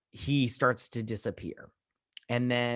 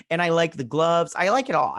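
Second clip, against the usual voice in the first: excited; 4 semitones higher, 6 dB louder than usual. Real, not acted. Enthusing about her costume.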